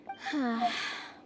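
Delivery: long tired exhale; heavy sigh. Acted. Weighed down by grief, a heavy sigh. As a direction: let the breath fall out wearily. Weary sigh before suggestion.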